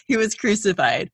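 normal talking voice

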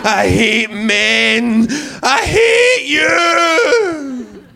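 slow, loud wailing rant; drunken rant. Retold or imitated. Drunk girl carrying her shoes, hammed-up slow wailing rant. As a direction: howl it like a tearful drunk at dawn. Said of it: "shoes over the shoulder going".

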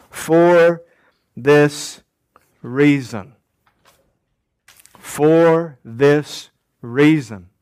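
slow, deliberate, separated words; measured emphasis with a pause between words. Shown as a demonstration. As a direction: set out each word on its own. Spells out the three-word phrase.

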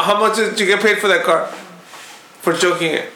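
ordinary talk, nothing marked

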